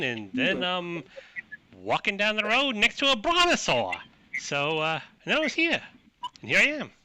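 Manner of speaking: put-on chatty Queens accent, swooping high; New York saloon woman. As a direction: tell your story in high-pitched New York, stretching key words.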